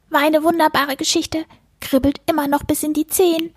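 high-pitched contented little creature; squeaky critter, pleased; furry book-eater. A narrator's reading. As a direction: speak as a satisfied furball after a meal.

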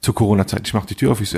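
usual speaking voice

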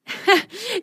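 high rising laugh. Acted. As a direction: burst out laughing. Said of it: "lachte Biene". (hohes Lachen)

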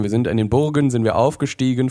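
ordinary talk

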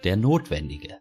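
weighty self-announcement; portentous. A narrator's reading. Official presenting himself to Greta, portentous. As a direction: announce your title with great significance. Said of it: "sagt bedeutungsschwanger".

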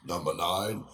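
low, slow, murmured "number nine"; imitating a low murmur. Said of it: "irgendwer sagt dann".

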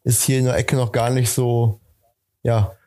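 usual speaking voice

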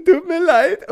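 high, loud laughing half-cry; laughing mock sob. Boy apologizing to his father, mock crying through laughter. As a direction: whimper sorry through laughter. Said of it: "ich so".

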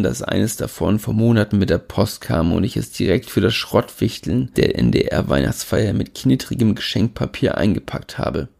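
ordinary delivery

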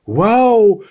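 high, drawn wow of delight; blurted amazement. A narrator's reading. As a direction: burst out impressed. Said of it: "platzte Blaustrümpfchen heraus". (begeistert herausgeplatzt, hoch)

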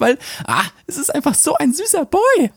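put-on high swooning girly voice; gushing girl. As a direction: swoon over the cute boy. Gushing about cute boy.

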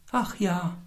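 hoch, leise und wehmütig; traurig. A narrator's reading. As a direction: wie ein trauriges Mädchen seufzen. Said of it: "mit trauriger Stimme". (traurige Mädchenstimme)

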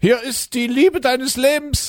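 high, slow put-on woman's voice; Annette from Schlettau. At the door, S1 plays Annette in a high slow voice. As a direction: play a sweet woman from Schlettau, pitched high. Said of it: "ich bin jetzt also die Annette".

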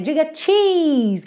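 cheese said high with a long ee; photo smile cheese. Shown as a demonstration. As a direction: say cheese like posing for a photo, ee drawn out. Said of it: "a gente fala assim". (diga cheese com i comprido, tom alto)

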